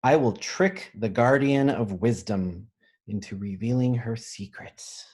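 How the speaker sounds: ordinary talk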